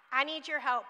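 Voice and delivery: normal talking voice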